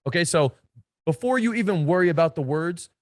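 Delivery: normal talking voice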